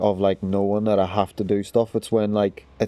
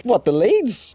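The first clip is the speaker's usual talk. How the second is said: high-pitched incredulous question; imitating own astonished reaction; retold disbelief; 13 semitones higher, slower than usual. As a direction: squeak it in mock shock. Retold disbelief at dad coming.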